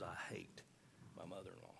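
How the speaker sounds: woman repeating her confession to the pastor, hushed; hushed retelling. Retold or imitated. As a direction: let it drop to a near whisper. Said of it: "look me square in the eyes and tell me that again".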